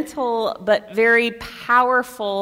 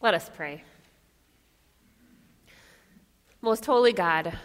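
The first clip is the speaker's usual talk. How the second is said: hushed, reverent prayer voice, getting quieter to the end; soft prayer; 3 semitones lower, 11 dB quieter than usual. Acted. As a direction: pray it softly for the congregation. Leads a prayer.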